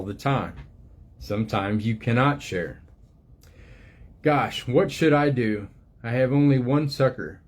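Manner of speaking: gentle storybook reading voice, unhurried; reading to children. Acted. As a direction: read it like a picture book to little ones. Reads book aloud to children.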